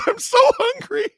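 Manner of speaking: high crying wail, loud and broken; sobbing hungry wail. Retold or imitated. Husband to wife in the car, high tearful wail. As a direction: wail it through tears like a starving man. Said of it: "I literally just burst into tears".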